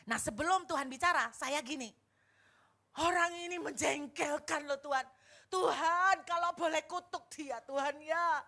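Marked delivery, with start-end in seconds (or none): 3.1-8.4 higher, louder furious prayer, building; angry complaint to God. Retold or imitated. Retelling her prayer to God about the landlord, furious and loud. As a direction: rant at God to curse the man, loud and high. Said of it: "Marah Bapak Ibu".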